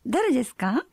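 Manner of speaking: high polite phone voice. Shown as a demonstration. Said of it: "do your phone voice".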